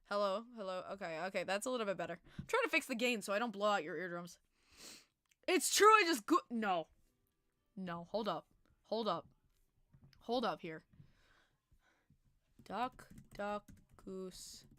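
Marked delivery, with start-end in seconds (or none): none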